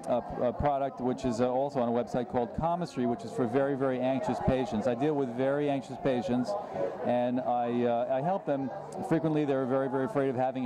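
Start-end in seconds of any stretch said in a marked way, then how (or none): none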